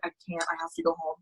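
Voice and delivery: soft, tired give-up; quiet and defeated. Retold or imitated. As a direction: let it fall away, drained. Exhausted, quitting the outing.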